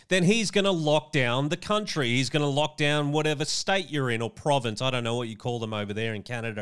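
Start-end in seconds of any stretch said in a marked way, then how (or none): none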